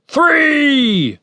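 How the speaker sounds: high, loud drawn-out count; shouted countdown. A narrator's reading. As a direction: bellow the number from outside. Commander shouts countdown from outside.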